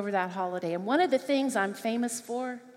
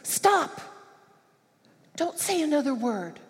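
put-on little boy's sudden shout; 5 semitones higher than usual. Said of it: "he goes".

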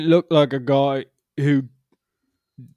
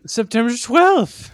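proud lady; 8 semitones higher, 6 dB louder than usual. Acted. Lady gives date proudly.

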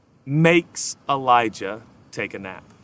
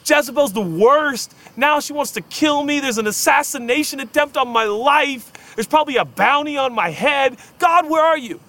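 high raw complaint to God; desperate prayer; 11 semitones higher, 4 dB louder than usual. Retold or imitated. Pastor voicing Elijah's raw prayer, high frustrated complaint. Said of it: "He was like".

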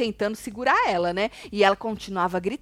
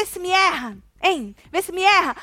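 high, raised-voice retort; mock angry brush-off; 8 semitones higher, 3 dB louder than usual. Fu turning on her accuser, mock angry brush-off. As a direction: snap back as if fed up.

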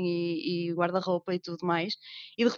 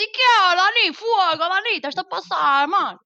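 put-on broad northern Portuguese accent, much higher and louder; 9 semitones higher, 8 dB louder than usual. Guest slips into her northern Portuguese accent, calling to Dani, loud and high. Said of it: "Logo, descaído".

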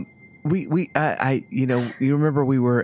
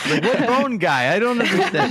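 baffled and giggling; confused laughing; 9 semitones higher than usual. Acted. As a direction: laugh through confused words. Voicing confused Esmeralda.

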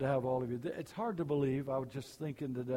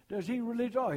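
Cajun talk; 8 semitones higher than usual. Retold or imitated. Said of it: "I love to hear that Cajun talk".

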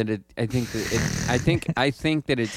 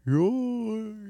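very high squeaky yawn call; yawn noise; 11 semitones higher than usual. Shown as a demonstration. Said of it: "you had your own thing".

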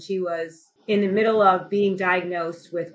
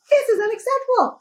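indignant; 4 dB louder than usual. Retold or imitated. Peak of the outburst.

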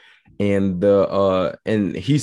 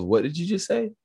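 higher, softer puzzled question; retold bafflement; 9 semitones higher, 5 dB quieter than usual. Guest quotes listeners lost by the native accent, bafflement. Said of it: "one of those".

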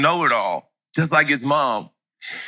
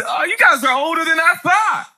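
ordinary talk

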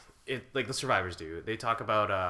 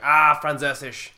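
"Ah, Französisch" blurted louder and slower like a swear; mock curse; 4 semitones higher, 9 dB louder, slower than usual. Using a German word instead of swearing, as a mock curse. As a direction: spit out Französisch as if cursing. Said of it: "every time I'm thinking of saying the F word".

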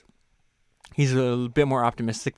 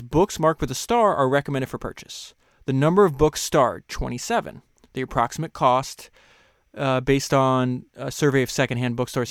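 raised reading voice; reading a quote aloud; 5 dB louder than usual. Acted. Host reading the book's list to listeners, clear.

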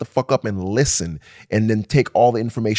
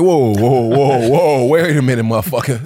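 mock alarm; 4 dB louder than usual. Pushing back on the food demand.

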